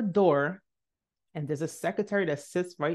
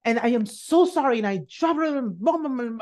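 higher, louder rambling apology; babbled apology; 5 semitones higher, 3 dB louder than usual. Retold or imitated. Student telling the professor everything, high gushing apology. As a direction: gush it out in a run. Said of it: "I started telling her about everything".

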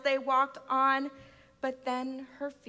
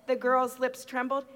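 usual speaking voice

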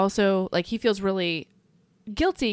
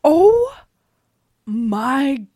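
stunned disbelief; 6 dB louder, much slower than usual. Real, not acted. Listener hears of boss counting to a hundred, stunned.

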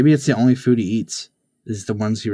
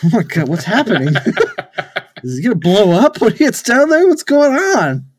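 mock panic; 7 semitones higher than usual. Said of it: "everyone's gonna be like".

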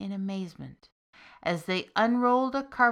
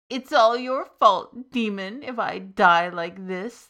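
crying out; 9 dB louder than usual. Acted. Starving soldier cries out blaming the demon. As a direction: cry out in blame. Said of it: "the soldier cried".

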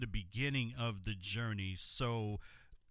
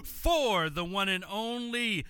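hype announcer; 7 semitones higher, 5 dB louder than usual. Acted. Hyped guest introduction on air.

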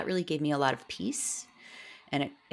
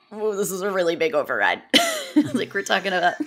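louder, laugh-tinged inner exclamation; exclaiming; 6 semitones higher, 9 dB louder than usual. Retold or imitated. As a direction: exclaim it half laughing at herself. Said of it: "And I was like".

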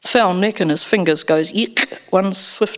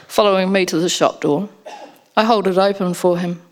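ordinary delivery

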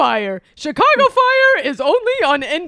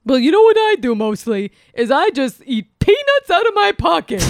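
boisterous birthday clown; 5 semitones lower than usual. Acted. Bunny voice continues.

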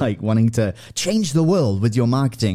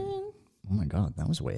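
stunned; 10 dB quieter than usual. Retold or imitated. Retold stunned reaction to money.

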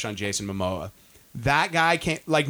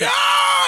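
very high, loud roar while smashing; mock rock star roar; 7 dB louder than usual. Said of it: "and he's like".

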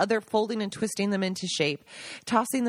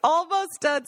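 high, louder called-out line; mock scream; 9 semitones higher, 4 dB louder, slower than usual. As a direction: call it across the kitchen. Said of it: "screams Rosanna from the".

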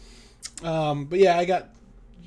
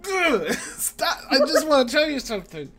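high, whiny mock protest; high-pitched plea with a laugh in it; exasperated pleading; 9 semitones higher than usual. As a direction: re-enact frustrated begging to be heard. Re-enacts pleading after being shushed.

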